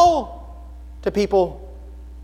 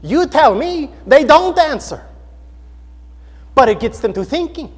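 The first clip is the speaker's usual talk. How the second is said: put-on higher, emphatic Indian accent; Balaswamy; 6 semitones higher, 4 dB louder than usual. Translator explaining that they don't answer, imitated Indian accent. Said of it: "with an accent like this".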